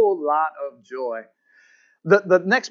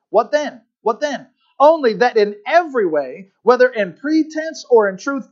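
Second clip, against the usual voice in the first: raised, emphatic scripture reading; preacher's climax with drawn-out key words; forceful preaching; 6 semitones higher, 4 dB louder, slower than usual. Acted. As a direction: build the verse to a triumphant declaration.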